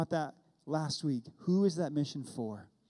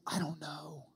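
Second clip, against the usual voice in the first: hushed whisper; 8 dB quieter than usual. Retold or imitated. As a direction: whisper it, awed and unsure. Brother describes meeting Jesus.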